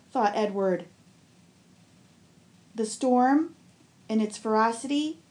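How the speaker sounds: ordinary delivery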